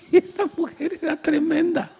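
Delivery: laughing through the words; amused and delighted at her boldness; said while laughing. Real, not acted. Preacher laughing at the story.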